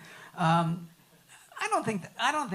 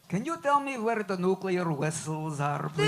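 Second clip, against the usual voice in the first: exaggerated Russian-accented film catchphrase; Chekhov's line; 5 dB louder than usual. Actor delivers his famous line for the audience, in Chekhov's Russian accent. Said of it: "what's the big line, baby?".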